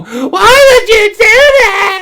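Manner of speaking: very high, loud, slow sobbing shout; mock sobbing. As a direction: wail it in drunken despair. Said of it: "Sobbing even more".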